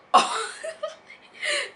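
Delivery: high burst of real laughter. Real, not acted. Laughing at her own splurge, real and high. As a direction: crack up at the memory.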